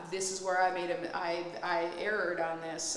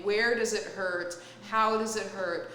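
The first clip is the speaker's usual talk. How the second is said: ordinary delivery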